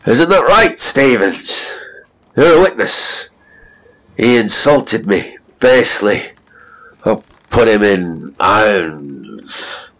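high, loud indignant rant; drunken ranting. Acted. Drunk captain appealing to dying Stavens, high and loud.

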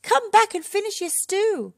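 very high, loud call; high-pitched holler that carries. A narrator's reading. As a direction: call someone back from far away. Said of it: "called Red".

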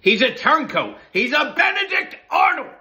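rising to a furious shout; mock outrage, yelling the betrayal; angry rant. Real, not acted. Angry rant about [name].

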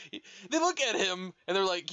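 ordinary talk

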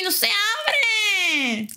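long, high, drawn-out cry; frustrated wail. Real, not acted. Stuck, repeats it louder.

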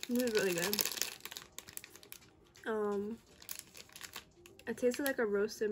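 talking through a bite; mouth full chewing. Real, not acted. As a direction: hand over mouth. Seen 0: Hand covers mouth while chewing until about 1.1s, then mouth looks clear.

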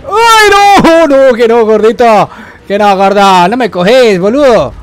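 2 loud, high frantic cries; 3 yell it while running for your life; 1 panicked yelling. Real, not acted. 4 player taunting and fleeing the fat enemy, loud panicked yelling.

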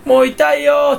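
high, loud, deadpan "ouch"; a monotone wail of pain; flat fake cry of pain. Showing the stock voice that comes out when hurt, a flat wail. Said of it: "こんな声出ますよ".

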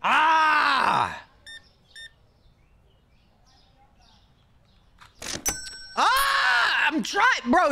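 high frustrated yells; panicking gamer. Real, not acted. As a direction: cry out in pure frustration. Frustrated yelling at game.

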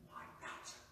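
whispered for awe. Acted. Repeated number dropped to whisper.

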